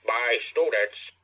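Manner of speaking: loud, slow pompous headmaster; self-satisfied bourgeois speechifying; smug bourgeois voice. Acted. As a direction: address the students smugly, drawn out. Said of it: "he said in a smug bourgeois voice".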